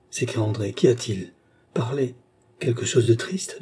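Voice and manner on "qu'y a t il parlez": usual speaking voice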